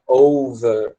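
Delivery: over with a long, heavy O and the R dropped, oh-vuh; over britânico, com O longo e forte; stressed British O. Shown as a demonstration. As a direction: stretch the O of over slowly. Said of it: "bem forte".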